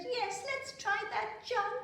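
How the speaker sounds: put-on high little girl's voice, slow; eager Prunella. A narrator's reading. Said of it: "So Prunella said".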